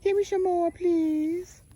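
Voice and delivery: high, drawn-out pretend animal begging; pleading animal. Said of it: "he said".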